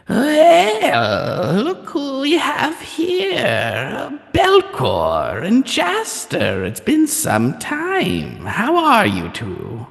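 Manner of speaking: high, slow crone's voice; old hag. Acted. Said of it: "an old crone's voice".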